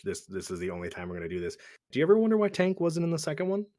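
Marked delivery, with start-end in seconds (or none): 1.9-3.7 excited. Real, not acted. Excited question about Tank.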